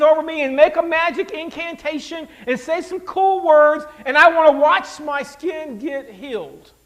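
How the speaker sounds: loud, high-pitched mock outrage; indignant tantrum. Naaman scoffing at the prophet's instructions, indignant. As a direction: play the insulted man demanding a showier cure.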